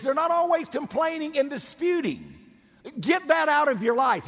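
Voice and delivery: rising exhortation. Real, not acted. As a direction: push it out to the pews.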